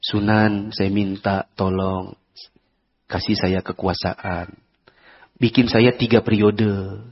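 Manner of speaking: low, slow, humble plea to the saint; pleading ruler at a grave. Retold or imitated. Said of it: "Terus dia ngomong misalnya".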